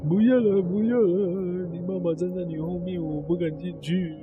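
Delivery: frightened refusal. Acted. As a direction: shrink back in terror, voice dropping away. Frightened uncle refusing to enter. (害怕地低声拒绝)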